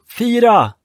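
high, loud sailor's call; shouted command. Acted. Red Nelson shouting orders to his crew. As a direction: call it across the water. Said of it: "Röda Nelson ropa".

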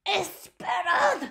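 high urgent call; a witch shouting wait. A narrator's reading. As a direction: call out to halt the others. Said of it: "volvió a gritar".

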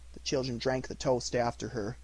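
ordinary delivery

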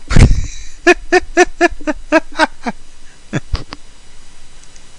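long airy laugh of disbelief; host can't hold it in at the guest's claim, laughing long; long breathy laugh. Real, not acted. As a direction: let a long laugh roll out, almost silent.

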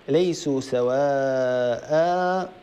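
slow drawn-out recitation; Quran recitation. Shown as a demonstration. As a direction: recite slowly with long vowels. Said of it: "تلاوة الآية". (تلاوة مجودة ممدودة)

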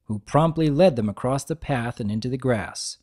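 usual speaking voice

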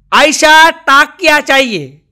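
Nepali customer asking. Retold or imitated. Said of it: "ત્યાં જઈને આમ તકિયા ઉપર હાથ રાખીને કે".

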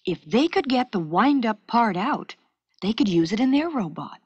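ordinary delivery

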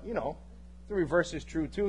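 ordinary delivery

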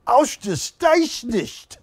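high, slow, drawn-out German exclamation; emphatic German. Shown as a demonstration. Showing Wilson German for fantastic, emphatic German accent. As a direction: pronounce it grandly in a German accent. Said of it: "Do you know what fantastic is".

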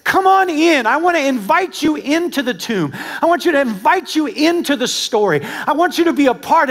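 lightly voiced, high pleading invitation; Christ inviting. Preacher voicing Christ inviting listeners in, lightly voiced invitation. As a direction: call them into the tomb. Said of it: "it's as if Christ is saying to you".

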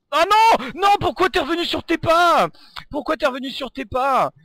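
high, loud frustrated cry; loud dismay. Real, not acted. Gamer yelling at a fleeing enemy, loud frustrated cry. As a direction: yell at the enemy who ran away. Target escapes, seconds left.

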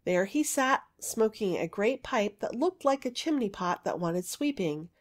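plain reading voice

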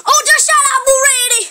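fast, high, loud scolding; exasperated yell. Acted. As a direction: blurt it out loudly at the baby. Babysitter loses patience with baby.

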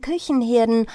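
plain reading voice

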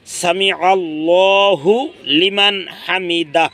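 সামি আল্লাহু লিমান হামিদা slow with a break after আল্লাহু; correct recitation. Shown as a demonstration. Teacher showing the correct split of the phrase, slow. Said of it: "আমি আবারও বলি".

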